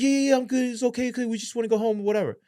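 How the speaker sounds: very high-pitched, dismissive reassurance; imitated girlfriend reassuring. Girlfriend telling police she just wants to go home, high voice. Voicing girlfriend brushing off concern.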